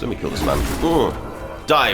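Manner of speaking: ordinary talk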